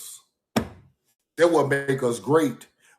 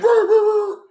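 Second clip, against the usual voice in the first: high growling police noise; imitated police rumble; mock siren growl; 4 dB louder than usual.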